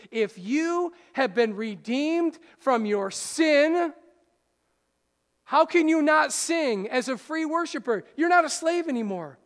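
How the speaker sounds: loud, high, rising sermon climax; full-force preacher build; fervent preaching. Acted. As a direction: preach it out, lifting the room.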